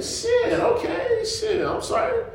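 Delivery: very high, slow sheepish sorry; mock apology. Nephew apologizing for telling on uncle, high mock sheepishness. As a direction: back down in a squeaky comic apology.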